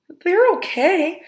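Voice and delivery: forced reassurance. A narrator's reading. Boy lying about tight shoes.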